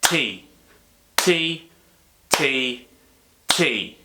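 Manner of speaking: T spoken once per even beat; one T per metronome click; steady beat. Shown as a demonstration. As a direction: tap out T, T, T, T evenly like crotchets. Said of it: "like this".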